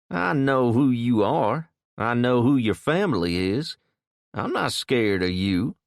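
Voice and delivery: put-on defiant young tough. A narrator's reading. Kid defies Jason.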